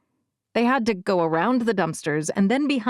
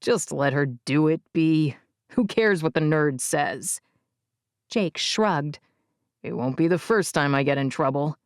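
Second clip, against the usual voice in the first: put-on lower, careless teenage boy's voice; dismissive older boy; 5 semitones lower than usual. A narrator's reading. Jake dismissing the narrator.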